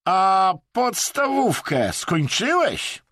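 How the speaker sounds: loud, high, slow challenge; attacking. A narrator's reading. Vadim attacks the Briton about his schooling, scornfully. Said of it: "zaatakował Brytyjczyka".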